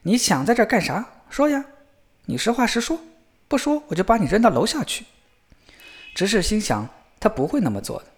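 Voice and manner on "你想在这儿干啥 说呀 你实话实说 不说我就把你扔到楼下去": high-pitched, threatening demand; shouted challenge. A narrator's reading. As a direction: press the intruder to speak up. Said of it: "小伙子又一次大声吼道".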